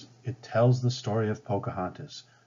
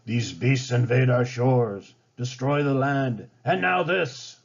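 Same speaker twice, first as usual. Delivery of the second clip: slow, louder rising fury; raging; 3 semitones higher, 4 dB louder than usual. Acted. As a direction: rage at the invaders as chief. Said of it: "Powhatan raged".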